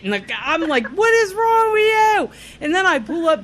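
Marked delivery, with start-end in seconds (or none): 0.9-2.3 high, stretched retold shout; mock outraged yell. Driver retelling going ape shit, mock outraged yell. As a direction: wail it at the other driver, hammed up.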